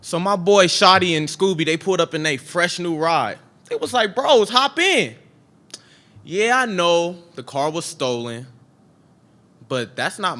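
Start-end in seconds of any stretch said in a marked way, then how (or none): 4.1-5.2 hyped call-out. Acted. Friends pulling up in a new ride, hyped call-out. Friends calling him into car.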